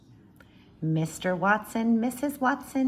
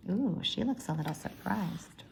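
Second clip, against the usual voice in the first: ordinary delivery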